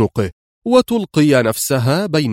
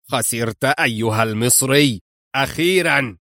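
gloating. Acted. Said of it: "بكل ظفر وزهو وشماتة الدنيا".

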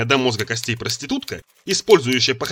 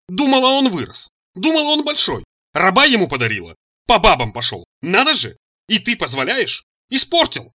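high, loud exasperated scolding; indignant tirade; 7 semitones higher, 5 dB louder than usual. A narrator's reading. Mother berates her grown son over the slave, indignant. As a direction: rail at him, pitch leaping up.

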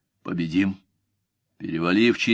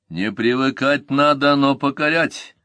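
firm and louder, pitched up; stern, hard command; 5 semitones higher, 4 dB louder than usual. A narrator's reading. Commander correcting a wavering slinger, stern and forceful. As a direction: lay down the rule harshly. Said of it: "жёстко проговорил Ганнибал".

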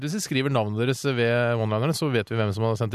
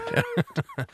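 squeaky giggle way up high; short high giggle; 3 dB quieter than usual. Real, not acted. Host giggles at his cohost's retort.

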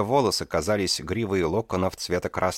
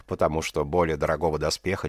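plain reading voice

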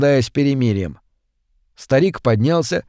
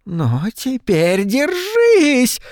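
high, slow, drawn-out shouted threat; 11 semitones higher, much slower than usual. A narrator's reading. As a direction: stretch it out as a menacing shriek. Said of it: "выкрикнула миссис Шефер". (выкрикнула)

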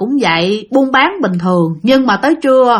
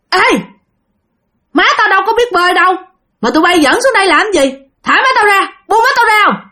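desperate shout; 8 semitones higher than usual. Acted. Son shouting at ghost children to free his mother, frantic. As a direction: scream at the kids to let go.

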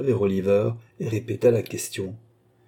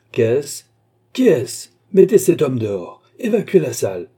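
loud, raised magistrate's voice, building from the first question; indignant shouting; 5 semitones higher, 8 dB louder than usual. A narrator's reading. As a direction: shout orders at the intruder. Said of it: "criait mister Fogg". (cris indignés d'un magistrat)

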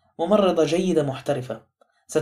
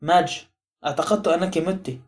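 plain reading voice

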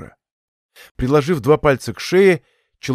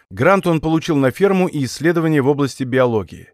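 ordinary delivery

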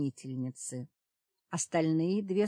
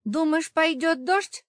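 much higher, loud strained voice; tense question; 11 semitones higher, 8 dB louder than usual. A narrator's reading. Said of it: "напряжённо спросил Йоган".

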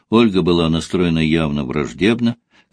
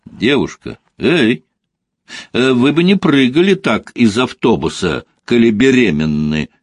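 higher-pitched, raised voice calling after someone; loud call; 5 semitones higher, 4 dB louder than usual. A narrator's reading. Man teasingly hails a woman off the bus, loud. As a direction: call out from behind to stop her. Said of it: "громко сказал Костя".

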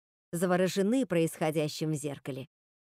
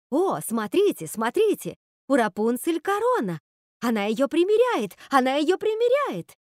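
high, loud excited squeal, building; excited cry; 6 semitones higher, 5 dB louder than usual. A narrator's reading. Sisters watch Rapunzel try on the crown, excited cry. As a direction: exclaim it higher and more urgently as it repeats.

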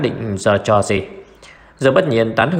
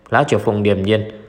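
plain reading voice

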